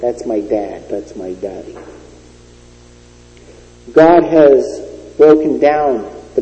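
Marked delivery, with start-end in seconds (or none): 0.0-1.8 tender. Acted. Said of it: "we would say".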